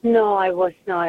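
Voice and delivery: usual speaking voice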